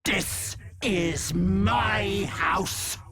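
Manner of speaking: slow menacing hag shout. Acted. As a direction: drag out each word in a threatening shout. Hag asserts territory.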